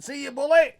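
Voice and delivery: high, loud sing-song jeer; a parting jeer at the waiter arriving for work, high and loud; taunting shout. Retold or imitated. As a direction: call it out as you walk past.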